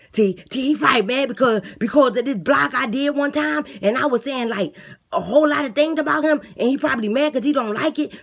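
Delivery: eager inmate talking up his plan; lisping boastful inmate. Acted. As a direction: beg the guard like a cocky jailbird. Inmate pleads to be celled.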